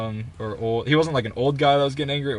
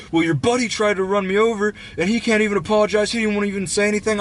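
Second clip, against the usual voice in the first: high, agitated mock rant; retold freak-out; 9 semitones higher, 3 dB louder than usual. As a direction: imitate him losing it over an apology. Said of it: "he starts freaking out".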